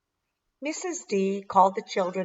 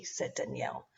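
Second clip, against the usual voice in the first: plain reading voice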